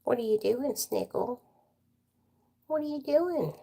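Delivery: lilting sing-song voice for the puppy; pet talk. Real, not acted. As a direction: coo at the sleepy dog. Talking sweetly to her puppy.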